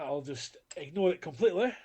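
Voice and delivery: usual speaking voice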